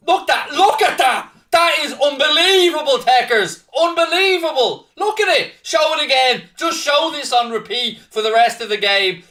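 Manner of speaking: overjoyed commentator gushing at full volume; ecstatic raving. Real, not acted. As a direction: rave over the replay in disbelief. Raving over replays of goal.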